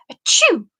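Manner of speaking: two high, loud sneezes; sneezing. Acted. Tag sneezing from the ice cream spell, loud sneezes. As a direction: sneeze hard, twice.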